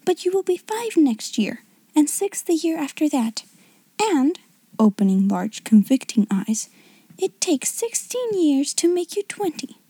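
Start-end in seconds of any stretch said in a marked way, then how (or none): 0.0-3.4 higher-voiced girl laying down facts; severe. A narrator's reading. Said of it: "she said severely to Lavinia". 4.0-4.4 put-on higher, emphatic girlish pause-word; severe. A narrator's reading. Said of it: "she said severely to Lavinia". 7.2-9.8 higher-voiced girl laying down facts; severe. A narrator's reading. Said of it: "she said severely to Lavinia".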